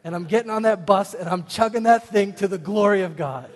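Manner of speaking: loud, rising, defiant order; rally the clerk to the cause; cheeky zealous shout to a store clerk; fired-up demand. Retold or imitated. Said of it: "I said to the clerk".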